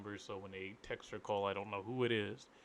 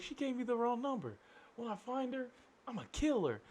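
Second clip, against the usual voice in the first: high, exaggerated caller voice; mock threat; 14 semitones higher than usual. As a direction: ham up the caller's angry threat, pitched high.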